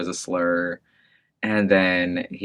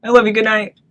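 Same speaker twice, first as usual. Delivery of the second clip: much higher, louder mom voice, kindly; imitating mom; 14 semitones higher, 8 dB louder than usual. Son voicing his mom checking on him, high mock voice. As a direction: lift into mom's high caring tone. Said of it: "And she's like".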